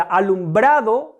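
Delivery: "alumbrado" with the weight on "bra"; stress on BRA. Shown as a demonstration. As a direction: punch the syllable "bra" in "alumbrado". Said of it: "la sílaba en la que recargo la voz es bra". ("alumbrado", recargando la voz en "bra")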